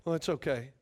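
quiet, low, hesitant wife. Retold or imitated. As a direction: mumble it like a wife holding back. Wife's reluctant answer.

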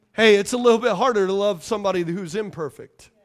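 usual speaking voice

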